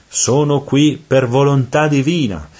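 high, loud declaration; shouting. A narrator's reading. Virgil declares his mission to charging devils, shouting. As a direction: call it out firmly to hold them off. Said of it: "gli gridò subito Virgilio".

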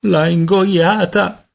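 high, drawn-out cry of dismay; horrified exclamation. A narrator's reading. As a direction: stretch it out in horror. Peter horrified the marble was swallowed.

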